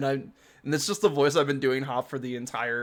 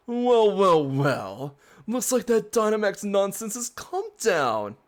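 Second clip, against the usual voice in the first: smug drawn-out well well well; a sly, gloating rival sneering; villain voice; 5 semitones higher, slower than usual. Acted. Rival gloats at the trainers, smug villain voice.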